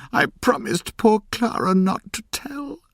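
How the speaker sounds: tearful cry. A narrator's reading. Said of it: "she cried".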